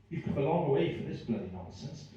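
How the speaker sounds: usual speaking voice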